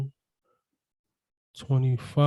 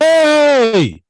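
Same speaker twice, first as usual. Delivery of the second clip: sudden high, loud yell; scream; 12 semitones higher, 7 dB louder than usual. Real, not acted. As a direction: shout it out as loud as you can. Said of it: "Yeah, I just screamed".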